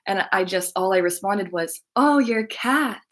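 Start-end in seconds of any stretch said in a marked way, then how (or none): 1.9-3.0 slow, higher-pitched cooing; tender sympathy. Retold or imitated. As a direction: draw it out with heartfelt sympathy. Sympathetic reply to the woman.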